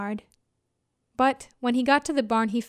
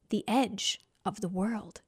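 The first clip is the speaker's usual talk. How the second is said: hushed; 4 semitones lower, 6 dB quieter than usual. A narrator's reading. Awed narration.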